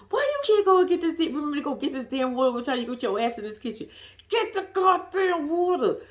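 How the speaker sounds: exasperated self-scold, lightly hammed; self-scolding rant. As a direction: chew yourself out, half laughing. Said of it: "this is to myself".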